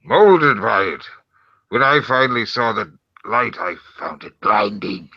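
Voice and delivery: theatrical masked villain; Bane. Pretend. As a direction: open loud, then declaim in a put-on voice. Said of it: "do your Bane voice".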